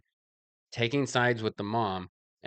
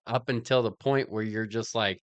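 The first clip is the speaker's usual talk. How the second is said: normal talking voice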